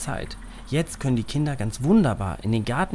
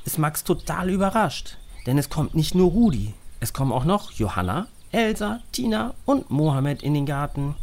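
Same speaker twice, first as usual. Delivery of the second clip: plain reading voice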